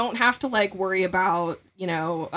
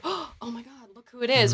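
starstruck gasp; 3 semitones higher, 5 dB quieter than usual. Retold or imitated. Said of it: "a sort of celebrity reaction".

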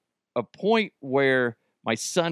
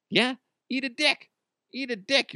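mock retort; 13 semitones higher than usual.

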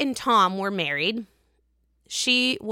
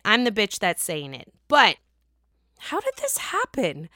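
ordinary delivery

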